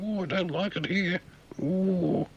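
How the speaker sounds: high, fretful whimpering voice; a scared learner in the water; nervous swimmer. Pretend. The nervous swimmer frets in the water, high whimper.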